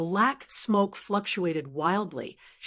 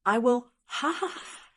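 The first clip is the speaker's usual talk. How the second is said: disdainful laugh; 3 semitones higher than usual. Acted. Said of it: "looked at him with disdain".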